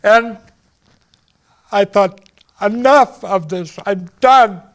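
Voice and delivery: high, loud, fed-up resolve; saying enough, voice strained high; agitated. Real, not acted. Emotional defiant recollection.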